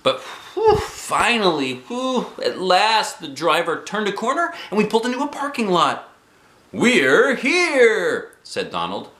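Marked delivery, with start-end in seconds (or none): 0.0-6.1 high, bright exhale of relief; relieved and excited. A narrator's reading. The bus trip finally ends, high relieved narration. 6.7-8.4 high, loud chirpy guide voice; cheerful tour guide. Acted. Guide Donald welcomes the bus people, high and chirpy.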